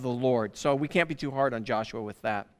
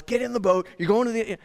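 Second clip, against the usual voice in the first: mock stern order; 5 semitones higher than usual. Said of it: "sternly remember him telling us".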